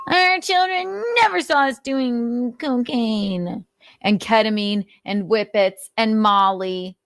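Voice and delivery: high, slow mock-innocent parent voice; mocking impression. Host mimics parents denying drug use to kids, high mock-innocent. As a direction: parody their denial as prim and pious.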